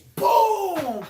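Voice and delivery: loud, high impact shout; explosive boom. Retold or imitated. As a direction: burst out with the sound of the hit. Imitates ball hitting the face.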